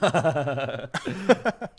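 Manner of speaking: a big burst of real laughter; sudden loud laugh at a self-deprecating joke. Real, not acted. Host laughs at fat joke.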